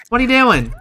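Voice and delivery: high, loud exasperated question; exasperated scolding. Real, not acted. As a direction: demand an answer from the misbehaving Sim. Scolds Sim not aging up.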